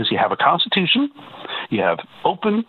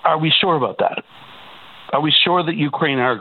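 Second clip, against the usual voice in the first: ordinary delivery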